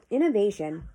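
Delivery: ordinary talk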